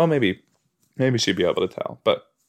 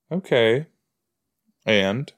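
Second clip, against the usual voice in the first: ordinary talk, nothing marked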